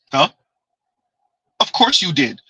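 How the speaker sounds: normal talking voice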